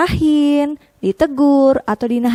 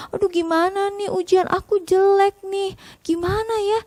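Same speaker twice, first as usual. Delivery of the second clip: put-on high, worried kid voice; worried child; 7 semitones higher than usual. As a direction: fret like a child with a bad grade. Child worried about bad grade. (anak cemas dan sedih)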